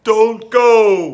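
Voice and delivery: very loud, high wail. Acted. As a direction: wail it, desperate. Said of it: "wailed Bear".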